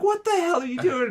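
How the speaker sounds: very high-pitched mock disbelief; incredulous. Friends to the kid yelling at rich houses, incredulous. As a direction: squeal it in baffled disbelief, retelling.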